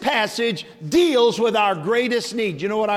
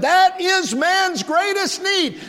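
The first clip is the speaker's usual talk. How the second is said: much higher, louder and slow; climactic cry; 13 semitones higher, 5 dB louder, slower than usual. Real, not acted. Preacher crying out man's need to the church, high and slow. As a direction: lift it to the peak of the sermon.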